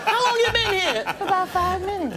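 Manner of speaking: high soft exchange. Retold or imitated. Chris and Michael whispering in the cinema, high soft. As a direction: keep it high and hushed. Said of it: "I'm like".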